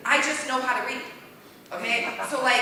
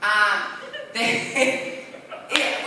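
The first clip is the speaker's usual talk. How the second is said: usual speaking voice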